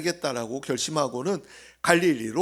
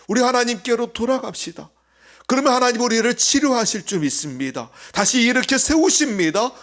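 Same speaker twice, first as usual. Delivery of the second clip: loud exhortation; 7 semitones higher, 4 dB louder than usual. Real, not acted. Same call now loud.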